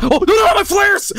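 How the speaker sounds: high, loud yelp of dismay; sudden high-pitched panic; panicked cry. Real, not acted. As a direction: cry out as it goes wrong. Flares wasted by accident mid-bit.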